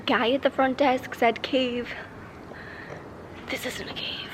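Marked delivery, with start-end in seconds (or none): 3.5-4.3 hushed, puzzled whisper; whispered aside. Real, not acted. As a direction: whisper it softly to the camera. Deflated aside at the wrong cave.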